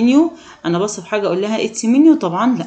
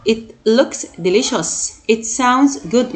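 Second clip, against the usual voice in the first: "It looks delicious. It sounds good." spoken word by word, very slowly; very slow model sentences; much slower than usual. Shown as a demonstration. Teacher reading examples to the class, very slowly. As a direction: stretch every word out for the learners. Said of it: "teacher models example sentences".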